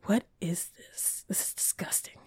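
hushed, grossed-out whisper; mock-disgusted whisper under the breath. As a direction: whisper it like the food revolts you. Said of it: "and I'm like".

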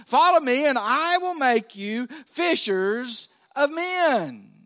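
loud call, then slow drawn-out promise; booming summons easing into a slow vow. Acted. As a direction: call out like Jesus summoning disciples. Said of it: "he said to them".

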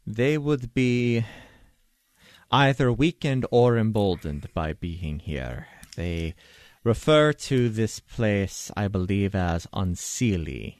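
slow, deliberate expert advisor; measured expert. Acted. Expert explaining the Fae to a companion, slow and measured. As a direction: explain it formally, with careful pauses.

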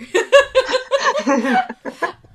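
big burst of real laughter; loud high laugh. Real, not acted. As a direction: crack up loudly. Laughing at the dishonor jibe.

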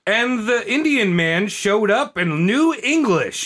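lightly mocking, slow storybook narration; storybook narrator. Mocking the Thanksgiving story, slow and storybook-like. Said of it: "when you hear a lot of these".

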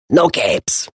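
lightly voiced Edna Mode, curt; a hint of Brad Bird's Edna, clipped decree. Impersonating Brad Bird doing Edna Mode for a friend. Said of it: "That's my Brad Bird impersonation".

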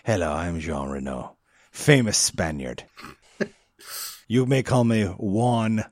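put-on low, slow accented movie-star voice; deep, quiet attempt at a foreign actor; Jean Reno. Introducing himself as a famous Spaniard, low Jean Reno impression. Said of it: "That's more like this".

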